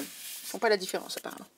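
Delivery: ordinary talk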